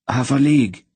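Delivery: tense. A narrator's reading. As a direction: say it through strain. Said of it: "Loldoran said tensely".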